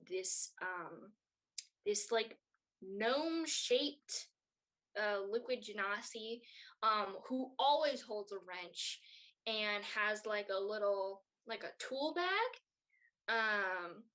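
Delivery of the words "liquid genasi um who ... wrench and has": normal talking voice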